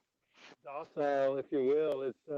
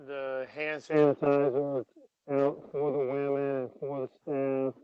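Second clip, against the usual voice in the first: ordinary talk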